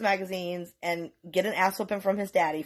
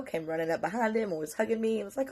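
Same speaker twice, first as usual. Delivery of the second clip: ordinary delivery, nothing marked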